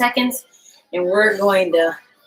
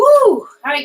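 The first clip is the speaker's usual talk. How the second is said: excited; 6 semitones higher, 6 dB louder than usual. Real, not acted. Cheer after finishing set.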